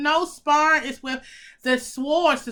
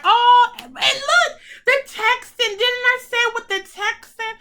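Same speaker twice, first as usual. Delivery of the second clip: excited; 9 semitones higher, 6 dB louder than usual. Real, not acted. Excited pointing at card imagery.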